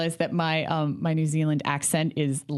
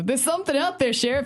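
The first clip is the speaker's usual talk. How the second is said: dramatic movie hero; 7 semitones higher than usual. Retold or imitated. Acted movie dialogue, hero line.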